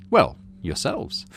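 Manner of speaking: ordinary delivery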